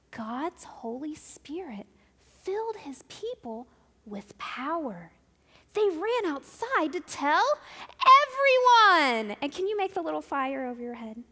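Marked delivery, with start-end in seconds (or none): 5.7-9.4 excited. Acted. Dramatic storytelling to children.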